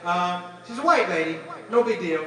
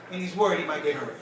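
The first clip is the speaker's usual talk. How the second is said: ordinary talk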